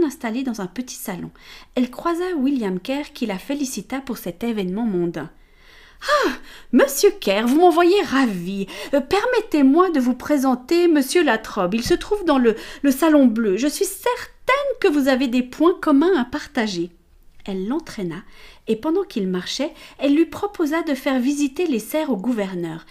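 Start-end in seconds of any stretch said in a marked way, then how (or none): 6.3-16.9 higher, louder, bubbly hostess voice; gracious hostess. Acted. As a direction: greet him with bright social charm. Amelia greets Mr Kerr. (hôtesse gracieuse, plus aiguë et plus forte)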